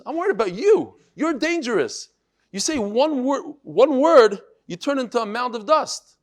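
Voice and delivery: high, loud, worried protest; a father refusing to risk his son; alarmed pleading. Acted. Father pleads, fearing Shimon.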